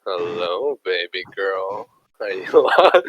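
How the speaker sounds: low, soft, slow creepy come-on; creepy pickup line. Retold or imitated. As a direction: lean in like a sleazy flirt. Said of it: "And so I was like".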